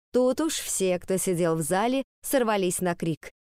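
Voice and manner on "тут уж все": usual speaking voice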